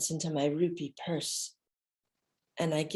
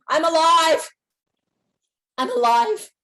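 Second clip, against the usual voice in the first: higher, loud cry of relief; elated cry; 11 semitones higher, 10 dB louder than usual. Retold or imitated. Thinking after escaping the crush, higher elated cry. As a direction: shout it out, amazed to be alive. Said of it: "And I'm thinking".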